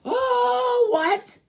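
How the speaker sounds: loud, high gasp of disbelief; shocked gasp. Real, not acted. Reacting to the letter's deed news, high shocked gasp.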